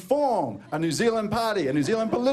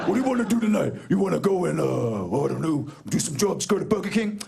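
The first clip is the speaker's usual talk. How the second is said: gang member voice. Retold or imitated. Acting gang members chatting.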